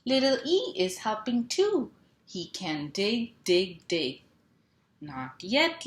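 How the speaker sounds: lively picture-book read-aloud with sound words; reading to children. Acted. Mom reading a truck book to kids, playful. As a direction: bounce the rhythm for little listeners.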